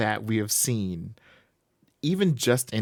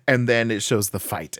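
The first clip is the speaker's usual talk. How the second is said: usual speaking voice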